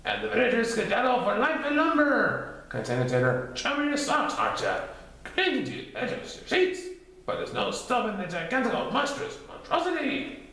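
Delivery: high, theatrical narration; dramatic storyteller. Acted. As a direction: ham up the drama. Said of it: "added Titter dramatically".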